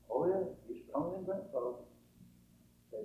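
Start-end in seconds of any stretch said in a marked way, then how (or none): 0.1-1.7 soft, reassuring fatherly answer; patient father. Retold or imitated. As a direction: answer the boy quietly, matter-of-fact. Father answers his little boy.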